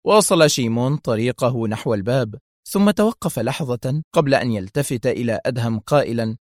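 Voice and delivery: usual speaking voice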